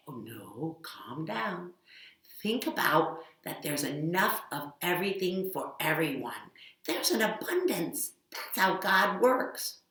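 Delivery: calm the cow down; soft gentle reassuring voice. Acted. Soothing the cow.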